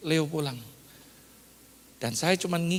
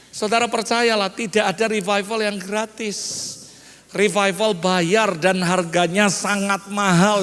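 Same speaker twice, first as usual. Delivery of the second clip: loud, emphatic sermon delivery; forceful preaching; 3 semitones higher, 5 dB louder than usual. Real, not acted. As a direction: drive each point home loudly to the congregation. (khotbah lantang dan penuh penekanan)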